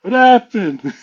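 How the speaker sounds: high, loud question breaking into laughter; retold laughing question. Storyteller voices friends who bust out laughing, amused question. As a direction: ask it while cracking up.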